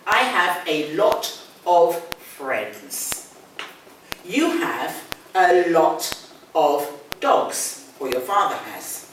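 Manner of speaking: very slow, each word laid out for learners; slow model sentence. Shown as a demonstration. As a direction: spell it out slowly like a teacher. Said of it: "teacher models example sentences".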